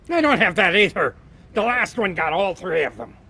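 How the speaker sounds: loud, high-ish leprechaun complaint; leprechaun protest. Acted. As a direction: grumble loudly as the wee leprechaun. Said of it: "the leprechaun".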